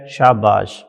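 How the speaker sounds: usual speaking voice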